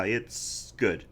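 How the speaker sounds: ordinary talk, nothing marked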